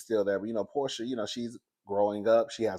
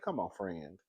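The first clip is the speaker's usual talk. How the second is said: knowing aside; 5 semitones lower, 13 dB quieter than usual. Retold or imitated. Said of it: "I said".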